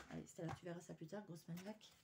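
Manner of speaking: low, soft, quick aside to yourself; muttered self-scolding. Real, not acted. Vlogger telling herself to leave it for later, muttered self-scolding. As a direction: scold yourself under your breath.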